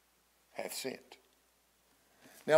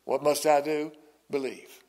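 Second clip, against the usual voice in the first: ordinary talk, nothing marked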